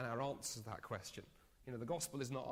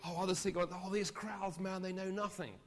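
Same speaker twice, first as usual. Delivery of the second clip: higher and louder, dragging out the scorn; scoffing disciple; 8 semitones higher, 6 dB louder than usual. Retold or imitated. Disciple scoffing to Jesus about the crowds, mock contempt. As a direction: sneer at the ignorant crowds.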